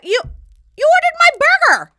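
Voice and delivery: very high, loud squeal; hammed-up high-pitched amazement; astonished outburst. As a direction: shriek it in stunned disbelief. Acted astonishment at him ordering for her.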